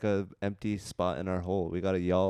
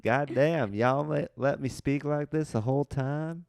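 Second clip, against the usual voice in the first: hammed-up Southern voice; Southern accent; 7 semitones higher, 5 dB louder than usual. Mock Southern outburst.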